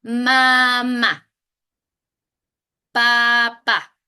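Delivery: word reading demo; clear word reading. Shown as a demonstration. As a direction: read each word for a beginner. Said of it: "la palabra como combinación de sílabas".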